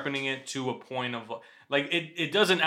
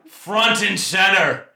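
loud, slow, higher barked order; drill sergeant; 6 semitones higher, 9 dB louder, slower than usual. Pretend. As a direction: call the troops to attention. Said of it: "like a drill sergeant".

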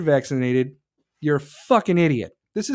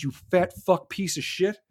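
ordinary delivery

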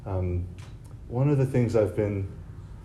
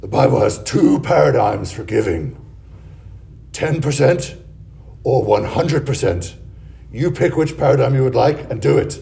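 put-on louder gravelly old priest voice; Father John Julian gravelly voice; 5 dB louder than usual. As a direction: rasp it out like a longtime smoker. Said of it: "he kind of spoke like this".

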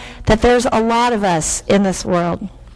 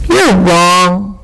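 arguing; 6 dB louder than usual. A narrator's reading. Island disputing the willow's claim, firmly arguing. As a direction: flatly tell them they're mistaken. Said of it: "argued the island".

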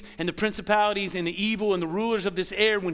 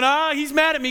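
a raised, louder cry of defeat; mock despair; 6 semitones higher, 6 dB louder than usual. Preacher mimics a fallen believer, raised and louder. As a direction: play the believer giving up. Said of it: "falling down and going".

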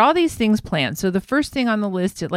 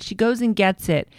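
usual speaking voice

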